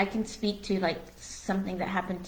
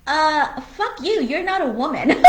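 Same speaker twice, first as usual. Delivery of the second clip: loud, higher, laughing defiance; retold angry comeback to the doctor; defiant retort; 7 semitones higher, 5 dB louder than usual. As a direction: throw it right back at him.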